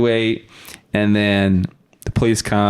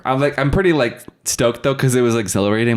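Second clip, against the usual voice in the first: usual speaking voice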